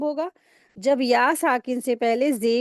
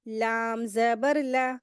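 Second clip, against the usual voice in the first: slow spelling drill; much slower than usual. Shown as a demonstration. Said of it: "कैसे पढ़ेंगे?".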